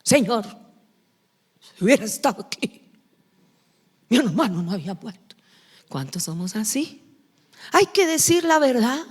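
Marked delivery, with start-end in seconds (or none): 0.0-0.4 a hint of a reproachful woman, quick; Martha's reproach. Preacher voicing Martha scolding Jesus, lightly reproachful. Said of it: "¿Cómo le habló la otra?". 1.8-5.3 quick, brisk complaint; Martha's reproach. Retold or imitated. Preacher voicing Martha rushing at Jesus, brisk. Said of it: "¿Cómo le habló la otra?".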